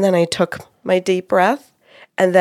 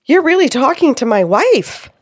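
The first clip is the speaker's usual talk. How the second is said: raised, loud, wondering voice; amazed disbelief; 5 semitones higher, 5 dB louder than usual. Retold or imitated. Client to the medium after breathing, loud and amazed. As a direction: say it loudly, still astonished.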